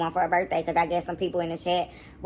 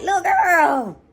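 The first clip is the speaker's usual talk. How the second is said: mock threat; 7 semitones higher, 4 dB louder, slower than usual. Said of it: "making me be like".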